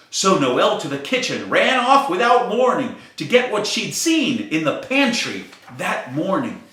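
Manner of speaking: plain reading voice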